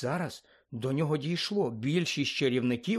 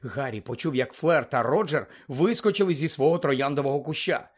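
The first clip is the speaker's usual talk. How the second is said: plain reading voice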